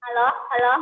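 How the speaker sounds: choppy, robotic phone line; cutting out on a bad connection; robotic broken call audio. Real, not acted. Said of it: "Suaranya robot".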